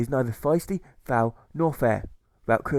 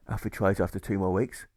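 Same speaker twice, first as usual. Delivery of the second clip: ordinary delivery, nothing marked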